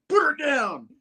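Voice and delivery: high-pitched rescue cry; heroic mock command. Spidey coming up behind Tombstone, high urgent command. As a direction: order it as the hero.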